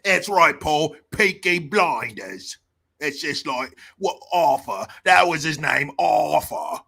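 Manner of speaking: hammed-up Cockney accent; put-on Cockney for laughs; mock Cockney. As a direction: play up a broad British gangster-show accent. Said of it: "the accent's called Cockney".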